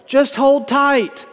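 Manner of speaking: high, slow, drawn-out plea; urgent call from the other. A narrator's reading. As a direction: call it up to the man holding the rope. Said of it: "hanging from rope, calls up".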